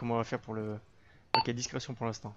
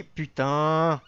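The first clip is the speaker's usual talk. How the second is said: angry shout; 3 semitones higher, 9 dB louder than usual. Real, not acted. Teammate just went down.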